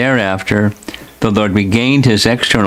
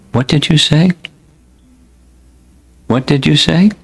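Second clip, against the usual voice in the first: higher voice with a rising question; inquiring; 6 semitones higher than usual. A narrator's reading. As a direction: ask it higher, lifting at the end. Narrator voices the Lord inquiring.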